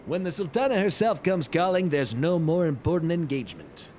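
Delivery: hammed-up manly lord with a put-on timbre; chosen Godbert voice. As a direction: deliver a courtly welcome as a man's man. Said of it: "that one is goddamn perfect".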